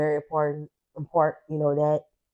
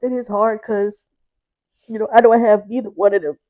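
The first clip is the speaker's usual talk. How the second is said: real tearful voice, higher and strained; higher voice, breaking into crying; 4 semitones higher, 7 dB louder than usual. Real, not acted. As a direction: push the words out through tears, louder at the end. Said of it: "I really was not trying to cry".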